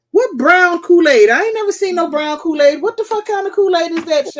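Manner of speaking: who ever heard of brown Kool-Aid; incredulous. Real, not acted. Host reacting to a viewer's brown Kool-Aid, incredulous.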